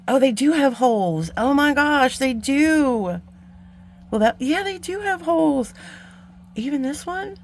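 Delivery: sudden realization, voice jumping up; surprised discovery. Real, not acted. Vlogger finds the beads have holes after all, high surprised delight.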